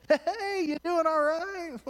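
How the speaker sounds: very high slow called-out concern; high sing-song call. Retold or imitated. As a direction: call down sweetly from the lift. Students calling from ski lift.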